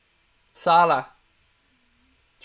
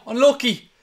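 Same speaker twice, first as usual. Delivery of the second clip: higher, louder cry of Unlucky; excited unlucky; 8 semitones higher, 5 dB louder than usual. Real, not acted. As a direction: shout Unlucky at the miss. Near miss, repeated.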